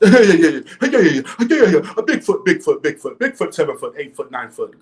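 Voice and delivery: a laughing, hammed-up take on [name]'s rap voice; mocking [name]'s [name] voice. Creator mocks [name]'s Bigfoot diss line in her rap voice. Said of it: "That's in her [name] voice".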